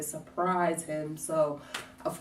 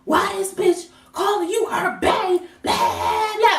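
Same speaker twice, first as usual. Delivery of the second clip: much higher angry demand; furious confrontation; 13 semitones higher, 4 dB louder than usual. Retold or imitated. To her boyfriend on the phone, going off in anger. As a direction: go off on him over the phone. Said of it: "I'm off. I'm mad".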